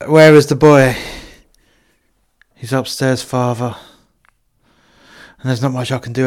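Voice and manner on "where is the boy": firm demand. Acted. Priest demands to see boy.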